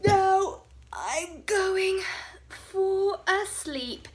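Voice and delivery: high, loud teasing; mocking. Bonehead teasing his friends for running, loud and gleeful. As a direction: gloat over the daft friends. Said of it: "Bonehead laughed".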